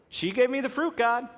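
high mock whine of excuses; whiny blaming. Shown as a demonstration. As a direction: whine it like a kid passing blame. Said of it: "they didn't have to say it like that".

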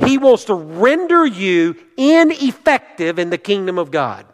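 high, urgent pulpit warning; fervent preaching. Acted. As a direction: drive each warning home from the pulpit. Preaches at full force.

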